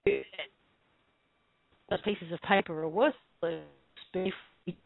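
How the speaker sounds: choppy breaking-up call audio; bad connection. Real, not acted. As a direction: voice cutting in and out. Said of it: "You're breaking up really bad there".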